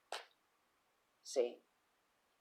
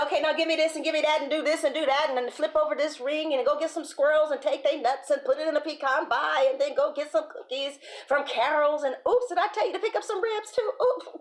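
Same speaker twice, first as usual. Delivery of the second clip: rapid-fire, high, loud string of demands; demanding son; 7 semitones higher, 6 dB louder, faster than usual. Acted. Speaker mimics a son piling on demands, rapid and high. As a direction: rattle off the orders in one rising rush. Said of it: "that son will just be like".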